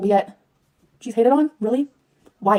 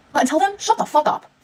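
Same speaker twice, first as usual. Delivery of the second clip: raised angry voice; angry outburst; 6 semitones higher than usual. Real, not acted. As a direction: shut the fuck up, sharp and higher. Ranting at haters.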